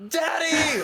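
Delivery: yelling up. Acted. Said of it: "look up at Johnny and yell".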